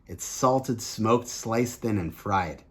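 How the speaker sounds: ordinary talk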